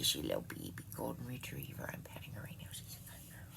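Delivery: soft unvoiced whisper to the puppy under her chair; whisper to dog. Real, not acted. Petting her golden retriever, she whispers softly. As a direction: breathe it gently so the dog stays calm.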